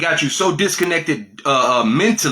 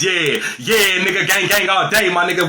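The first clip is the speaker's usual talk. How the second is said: loud, raised homeboy banter; 7 semitones higher, 4 dB louder than usual. Shown as a demonstration. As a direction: put on a lively voice for the homeboys.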